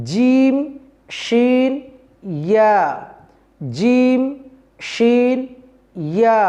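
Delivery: carefully articulated Arabic letter names jim, shin, ya; letters named clearly. Shown as a demonstration. Teacher naming the letters for students, carefully articulated. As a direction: say জিম, শিন, ইয়া slowly and distinctly, each on its own. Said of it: "অক্ষরগুলি কি কি?".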